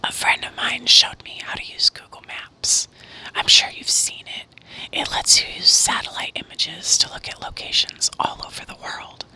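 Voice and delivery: ordinary delivery, nothing marked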